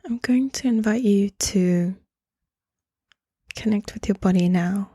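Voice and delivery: calm meditation voice, leaving space between phrases; guided meditation. Acted. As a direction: gently guide the listener into stillness, phrase by phrase.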